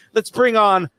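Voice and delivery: high, loud showman's guest intro; hype announcer. Acted. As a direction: build the crowd up for the guests.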